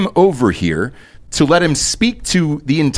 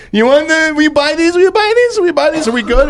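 high, squeaky pestering voice; mock high-pitched sales pester; imitating eager cookie sellers; 13 semitones higher than usual. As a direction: pitch way up, repeating like an insistent little kid. Imitating Girl Scout cookie sellers.

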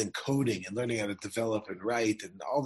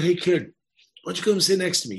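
louder, higher, friendly-stranger call; calling out to someone; 6 semitones higher, 8 dB louder than usual. Retold or imitated. Man inviting the kid to sit beside him, calling out. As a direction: pitch it up and call across the seats.

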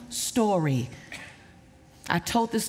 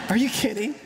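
normal talking voice

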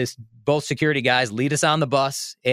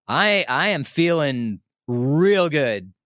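slow, stoned, mellow drawl; real relaxed; much slower than usual. Retold or imitated. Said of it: "I must have been talking real relaxed".

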